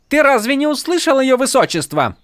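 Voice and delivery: high, sharp rebuke; scolding attendant. A narrator's reading. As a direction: put the soldier in his place. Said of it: "одёрнула его Тин".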